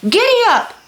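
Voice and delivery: high, loud call to the oxen; boy shouting at his steers; shouted command. A narrator's reading. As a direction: yell it at the team to move. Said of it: "cracked his whip and shouted".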